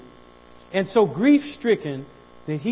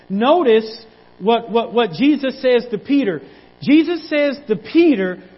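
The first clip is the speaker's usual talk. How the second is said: higher, louder preacher's cry, slowed at first; full-force preaching; 6 semitones higher, 8 dB louder than usual. Acted. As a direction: preach it out, building.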